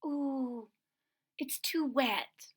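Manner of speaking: a gentle whispered no from mom; mom's whisper. Acted. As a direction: breathe it softly like a mother refusing. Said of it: "but she said".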